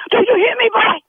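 angry scolding. Real, not acted. Secretary scolding caller.

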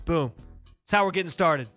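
ordinary talk, nothing marked

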